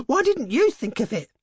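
high, frantic reproach with stress on "you"; hysterical. A narrator's reading. Wife blaming her husband, hysterically stressing "you". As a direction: turn it on him, hitting "you" hard and high. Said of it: "she said hysterically".

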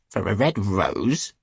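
slow, questioning disbelief; incredulous cry. A narrator's reading. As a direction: echo it back as an astonished question. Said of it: "they cried".

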